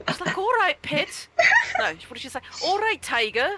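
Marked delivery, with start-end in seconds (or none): 0.4-1.3 high, imitated Welsh lilt; put-on Welsh accent, pitched well up; Welsh co-worker. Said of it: "I had a Welsh co-worker". 2.6-3.5 high, imitated Welsh lilt; put-on Welsh accent, pitched well up; Welsh co-worker. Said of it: "I had a Welsh co-worker".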